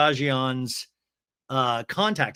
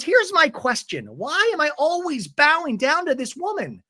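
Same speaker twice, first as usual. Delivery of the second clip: high-pitched, louder exasperated demand; mock indignant; 13 semitones higher, 3 dB louder than usual. Recapper acts Tamara refusing to bow down, mock indignant. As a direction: protest in a raised, put-on voice.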